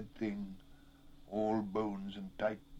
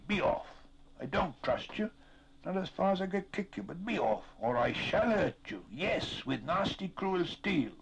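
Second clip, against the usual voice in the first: high-pitched indignant threats; scolding, raised-pitch warning; angry dismissal; 9 semitones higher than usual. A narrator's reading. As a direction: drive off someone you distrust. Sam angrily drives the creature away.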